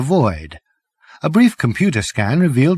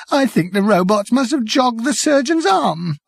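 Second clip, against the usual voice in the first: high, tearful voice; weepy, shaken journalist; 10 semitones higher than usual. Acted. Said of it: "He started to cry".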